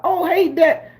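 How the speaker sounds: high mocking girl's cry. Mocking a girl in a flick, high and teasing. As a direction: imitate an actress yelling out.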